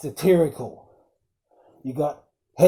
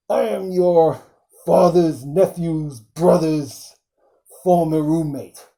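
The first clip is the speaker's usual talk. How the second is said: put-on Dark Helmet voice, slow and louder; Dark Helmet impression; 4 dB louder than usual. Fan quoting Dark Helmet to the viewers, a hammed-up villain voice. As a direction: ham up the villain's grand reveal. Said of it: "Dark Helmet".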